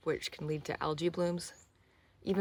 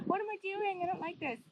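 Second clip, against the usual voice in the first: much higher, squeaky and uneasy; a pitched-up whine at the cold; in ice cold water. Real, not acted.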